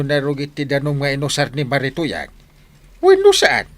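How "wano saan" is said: loud, much higher accusing cry; a shrill-free but loud outraged challenge; indignant. Acted. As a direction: demand it sharply and high. Suspicious complaint about dirty food.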